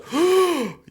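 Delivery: sharp high imitated gasp of a shocked crowd; quick mock gasp. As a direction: gasp like a stunned audience. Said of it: "the whole crowd goes".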